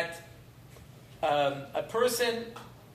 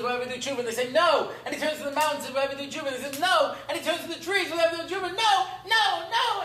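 storytelling that climbs higher with every refusal; mounting desperation; 6 semitones higher, faster than usual. A narrator's reading. Sinner's rising desperate plea in story.

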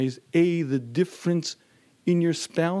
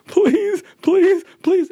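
high, loud desperate please; frantic begging; 9 dB louder, much slower than usual. Retold or imitated. Wife begging her husband on the phone, high, loud and desperate. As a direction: plead as if terrified.

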